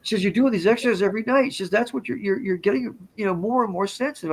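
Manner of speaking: high-pitched imitation of an amused woman; amused friend, retold. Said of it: "she starts laughing again".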